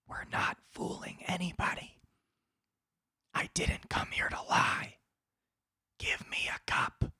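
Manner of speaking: hushed whisper to the server. Pretend. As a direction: lean in and whisper it like a secret. Stand-up bit, conspiratorial aside to server.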